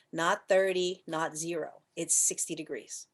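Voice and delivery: ordinary delivery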